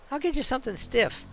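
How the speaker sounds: put-on bubbly bartender in a rough New York accent. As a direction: greet him like a Brooklyn barmaid. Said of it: "a bit of a New York-ish kind of accent".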